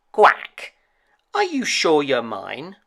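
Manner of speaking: loud quacking mother duck; a doubting mother duck, quack and all. A narrator's reading. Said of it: "she said".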